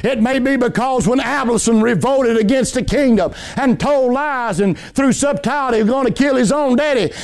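ordinary talk

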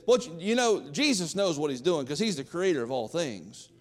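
ordinary talk, nothing marked

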